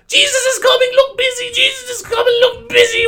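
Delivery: very high, loud screeching holler; frantic high-pitched yelling; manic shouting. Pretend. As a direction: holler it like a madman tearing round a shop. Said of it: "ran around the shop going".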